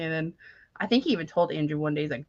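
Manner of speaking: ordinary talk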